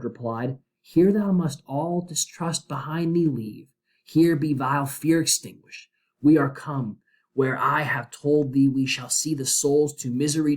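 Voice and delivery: verse read with rising, lifted pitch; dramatic poem reading. Acted. Teacher voicing Dante and Virgil for the class, dramatic reading. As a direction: lift the old lines with a storyteller's weight.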